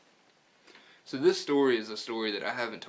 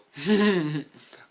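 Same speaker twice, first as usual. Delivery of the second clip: loud embarrassed laugh; burst of laughter; 7 semitones higher, 12 dB louder than usual. Real, not acted. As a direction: laugh off a clumsy fumble. Laughs after dropping the dip.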